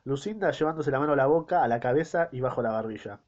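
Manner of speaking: ordinary talk, nothing marked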